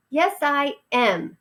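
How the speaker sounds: stoutly. Acted. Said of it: "said Ralph stoutly".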